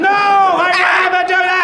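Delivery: high, loud shouted refusal; hammed-up yell of refusal; mock outraged refusal. Podcaster refuses his begging co-host, mock outrage.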